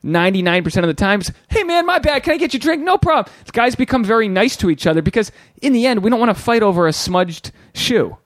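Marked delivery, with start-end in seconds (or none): 1.5-3.3 much higher, chummy bar-guy voice; friendly bar guy. Retold or imitated. Imitating guys at a bar, higher and overly friendly. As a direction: play a nice guy smoothing over a bump at the bar. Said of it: "when a guy's brushing to another guy".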